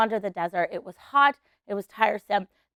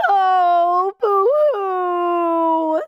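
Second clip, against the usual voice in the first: hammed-up boo-hoo wail, higher and louder; mock crying; 7 semitones higher, 6 dB louder, much slower than usual. Shown as a demonstration. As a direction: fake a big cartoon sob for the kids. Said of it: "everyone's gotta do it with me".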